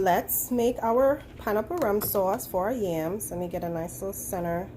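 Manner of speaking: ordinary delivery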